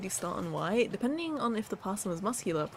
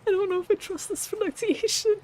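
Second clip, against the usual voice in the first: mock high voice; 13 semitones higher, 5 dB louder than usual. Doubting the translator's voice.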